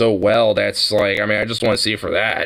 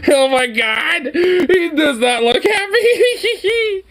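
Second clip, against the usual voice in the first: crying with laughter, much higher; laughing whine; much slower than usual. Retold or imitated. Friend laughs imitating the jealous boyfriend, laughing high whine. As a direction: squeal it through laughter.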